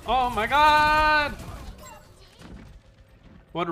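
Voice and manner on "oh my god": shocked. Real, not acted. Shocked reaction during game.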